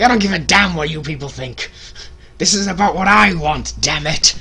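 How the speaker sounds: loud, high mock Vince bluster; Vince's defiant speech. Vince defying the booing crowd, mock loud. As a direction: declare it like the boss. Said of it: "It's like".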